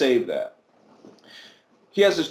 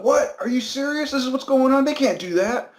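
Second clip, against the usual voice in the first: much higher, incredulous dad; shocked disbelief; 11 semitones higher than usual. Retold or imitated. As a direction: react like you can't believe your ears. Dad reacts to the son's news.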